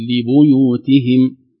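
لبيوتهم slowly as in Hafs with bu; Hafs reading. Shown as a demonstration. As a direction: stretch لبيوتهم the correct Hafs way. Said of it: "الصحيح في حفص".

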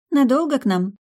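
high, light, curious voice; curious waitress. Acted. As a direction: ask with friendly interest. Waitress asks guest.

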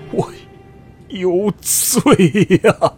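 hushed, then wailing high and slow; hoarse weeping. Acted. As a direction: confess the guilt, then break into a wail. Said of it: "扶着床沿哑声哭道". (沙哑地哭喊认罪)

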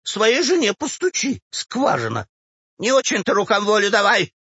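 put-on high, huffy old woman; offended old woman. A narrator's reading. Old woman rebukes the clerk's gesture, offended. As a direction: scold him indignantly in a high voice. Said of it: "обиделась старуха".

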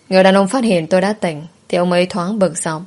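ordinary delivery

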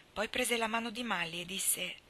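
ordinary delivery, nothing marked

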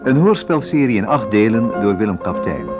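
ordinary talk, nothing marked

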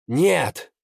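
loud pained shout; shouting while wounded. Acted. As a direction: cry out through the pain. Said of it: "сквозь боль выкрикнул".

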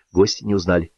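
ordinary delivery

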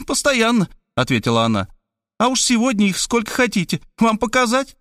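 high, cheeky woman's voice; woman offering to show her scraped knees. Acted. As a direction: tease him boldly. She answers about her knees.

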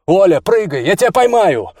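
high, loud urgent call; shout over noise. A narrator's reading. Man calls Olya to jump from helicopter, shouting. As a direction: shout up over roaring rotor blades. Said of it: "крикнул он".

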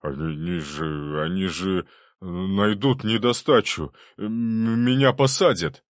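halting, stammering dread that builds in pitch and volume; stuttering frightened official; trembling panic. A narrator's reading. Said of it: "Толстые губы губернатора затряслись".

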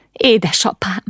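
a touch of a sob in the voice; sobbing. A narrator's reading. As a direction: cry out in distress, not full weeping.

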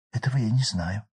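usual speaking voice

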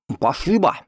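thanks through a mouthful; mouth full. Acted. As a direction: mumble with your mouth full. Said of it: "пробормотал с набитым ртом".

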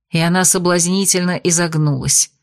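ordinary delivery, nothing marked

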